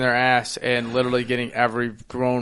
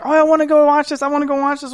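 put-on much higher eager girlfriend voice; imitating girlfriend; 13 semitones higher, faster than usual. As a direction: mimic Kaylee begging to see the film. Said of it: "she's like".

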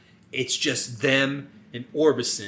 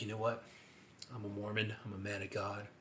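very soft, low and measured; hushed, mock-earnest conscience; solemn reflection; 5 semitones lower, 19 dB quieter, faster than usual. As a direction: think it over quietly, with pauses. Acts Joe pausing to reflect.

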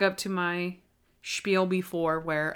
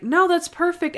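higher, eager mock Monk; lightly voiced Monk, quick to approve; a hint of an eager Monk; quoting Monk; 9 semitones higher than usual. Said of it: "And he's like".

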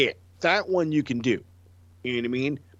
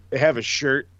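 ordinary delivery

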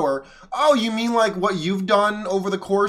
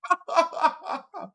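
disbelieving laugh; 10 semitones higher, 4 dB quieter than usual. Real, not acted. Laughs at clip's claim.

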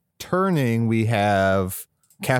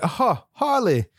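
mock Australian accent; 6 semitones higher than usual. Captain Boomerang mocking Harley on the copter, in a hammed-up Australian accent. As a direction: take a jab in a jokey Australian accent. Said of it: "in this fucking weird accent".

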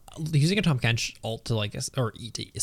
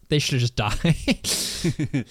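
laughing; 2 semitones higher, much slower than usual. Real, not acted. Joking while laughing.

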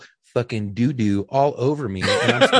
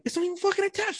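much higher, incredulous mock outburst; retold indignant disbelief; 6 dB quieter, faster than usual. Retelling his indignant discovery.

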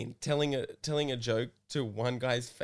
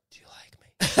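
whispered request; 6 dB louder than usual. Retold or imitated. Host leans over guest's bed at night, whispering. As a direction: whisper it like someone's asleep.

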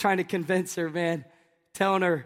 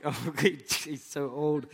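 low, slow mocking teen voice, softer; mock teenager; 7 semitones lower, 6 dB quieter, slower than usual. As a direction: sneer it like a bored teenager. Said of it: "you're like".

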